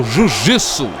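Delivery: low, pouty Trump-style jiu-jitsu; Donald Trump mouth. Retold or imitated. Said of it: "I make my mouth like Donald Trump".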